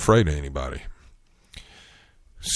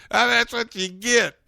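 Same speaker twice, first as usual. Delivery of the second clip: mocking laugh. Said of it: "she was laughing at him".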